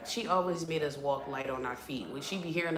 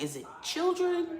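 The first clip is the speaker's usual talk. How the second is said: mock high question; 13 semitones higher than usual. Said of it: "I'm like".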